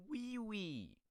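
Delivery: high, loud kiddie squeal; childish word. Acted. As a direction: sing it out high like a small kid. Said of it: "ones that your kid will learn".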